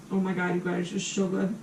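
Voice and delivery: talking while chewing; mouth full. Real, not acted. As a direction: speak through a mouthful of food. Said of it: "Excuse me for chewing with my mouth open".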